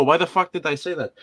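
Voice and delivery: normal talking voice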